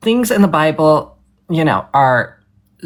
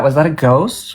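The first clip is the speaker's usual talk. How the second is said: ordinary delivery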